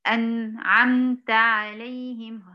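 "أنعمت عليهم" with a gap after the nun; slow faulty demo of "أنعمت"; wrong pause demo. Shown as a demonstration. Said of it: "هذا خطأ".

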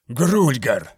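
loud, cursing the name; spat like a curse. A narrator's reading. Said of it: "Имя, словно проклятие".

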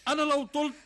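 husband vowing devotion, high and loud; passionate vow. Acted. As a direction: pour it out like a lovesick promise.

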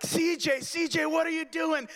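high frantic calling; panicked call. Retold or imitated. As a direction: plead with the dog to come.

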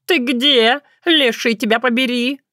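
high, scolding cat voice; wailing cat. A narrator's reading. Cat searching for the naughty girl, wailing scolding. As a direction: holler crossly for someone lost. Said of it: "с завываниями орал Баюн".

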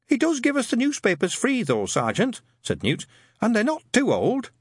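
a meek, polite recruit; timid young private. A narrator's reading.

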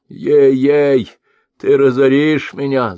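put-on high, loud wailing old merchant; groaning blind merchant. A narrator's reading. Blind merchant groans as gold pours into helmet. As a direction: moan like a man being robbed. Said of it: "заохал слепой".

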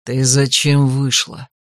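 low, slow displeased demand; hissed reproach. A narrator's reading. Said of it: "прошипел герцог". (низко, медленно, с упрёком процедить)

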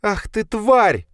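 plain reading voice

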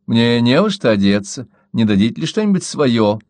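plain reading voice

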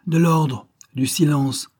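high, loud call to order; scolding director's command; railing at a crowd. A narrator's reading. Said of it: "vitupéra monsieur Paul".

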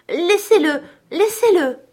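put-on very high, loud child's plea; desperate little girl's shout, each plea falling at the end; tearful outcry. A narrator's reading. Little girl begging the man to leave the boy, crying out. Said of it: "s'écria la petite à travers ses larmes".

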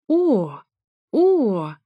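high, loud acted moans; loud mock moan. A narrator's reading. Narrator outdoing the lovers next door, loud high moaning. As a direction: moan theatrically, twice. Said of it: "простонала ещё громче".

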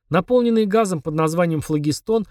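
ordinary delivery, nothing marked

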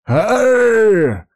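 high loud yelp; pained cry. A narrator's reading. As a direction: cry out in pain. Fighter hit.

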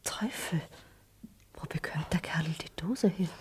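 soft whispered wonder; curious whisper to herself; whispered aside. Acted. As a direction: wonder it under her breath. Aside about the snuffbox.

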